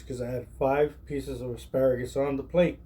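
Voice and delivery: usual speaking voice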